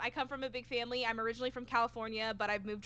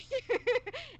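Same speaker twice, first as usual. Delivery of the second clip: bright laugh; 12 semitones higher than usual. Real, not acted. Laughing at friends' question.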